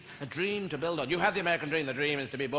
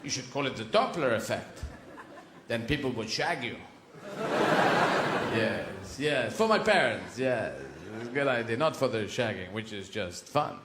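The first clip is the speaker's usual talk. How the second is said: low academic voice; 7 semitones lower than usual. Acted. Second character answers scientist.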